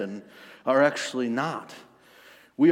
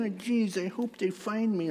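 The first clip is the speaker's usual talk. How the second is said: high, feeble little put-on voice; hammed-up feeble wishing, pitched way up; mock weakly; 8 semitones higher, 5 dB quieter than usual. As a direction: whimper it timidly, making fun of weakness. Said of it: "weakly saying".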